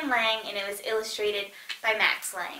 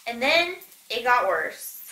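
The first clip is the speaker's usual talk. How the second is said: ordinary delivery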